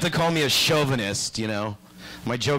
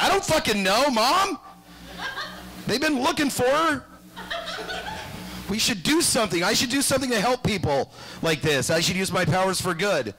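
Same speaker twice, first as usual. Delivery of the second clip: snapping back at mom, then turning noble; a hint of an exasperated kid; defensive Clark; 9 semitones higher than usual. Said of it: "And he's like".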